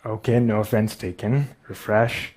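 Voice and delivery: ordinary talk, nothing marked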